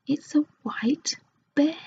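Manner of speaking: hushed, half-scared; half frightened. Acted. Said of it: "half frightened".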